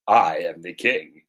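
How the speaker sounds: low, slow, weighty reveal of "I am the king"; solemn declaration. Acted. King reveals himself to the beggar, low and stately. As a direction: draw out "I" low and grand.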